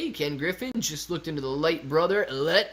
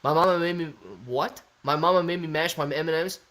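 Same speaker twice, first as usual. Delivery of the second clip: ordinary delivery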